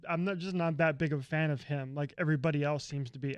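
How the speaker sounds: ordinary delivery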